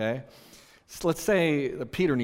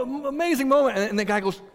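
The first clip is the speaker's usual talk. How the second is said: ordinary delivery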